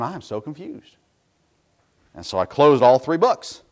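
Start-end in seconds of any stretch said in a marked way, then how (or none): 0.0-1.0 much softer, puzzled aside to himself; a hint of retold bewilderment; confused murmur. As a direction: mutter it in bafflement. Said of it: "I'm sitting there going".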